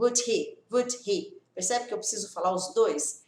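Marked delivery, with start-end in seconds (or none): none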